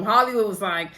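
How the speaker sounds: ordinary talk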